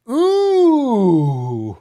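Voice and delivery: delighted shriek of a laugh, very high and loud; high squealing laugh. Real, not acted. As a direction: squeal with glee. Reacting to own joke.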